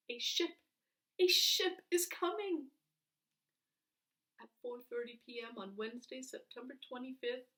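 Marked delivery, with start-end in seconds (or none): 0.1-2.7 higher, louder thrilled announcement; elated news of the ship; excited. A narrator's reading. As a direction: burst out with joy. Boys spotting the rescue ship.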